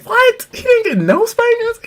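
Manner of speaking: put-on high, incredulous kids; kids' disbelief. Grandkids stunned by their grandfather's claim. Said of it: "we was like".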